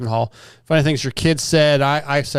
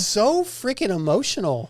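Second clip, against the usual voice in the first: mock teasing voice; 6 semitones higher than usual. Teasing emotional friend.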